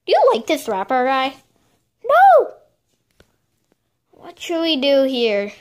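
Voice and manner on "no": high, loud sharp refusal; emphatic no. Acted. Asked about the rapper, a loud high refusal. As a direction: shoot the idea down hard.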